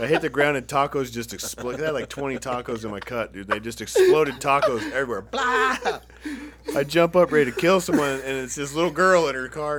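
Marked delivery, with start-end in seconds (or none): 5.2-5.8 high, loud splat sound; explosion noise. Retold or imitated. Showing the tacos exploding, with a loud splat. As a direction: make the tacos burst.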